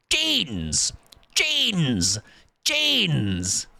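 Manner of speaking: lightly mimicked presenter, "Jeans!" drawn out and falling; a mild mock James May, stretching "Jeans!" each time; James May style. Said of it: "James May saying cheese".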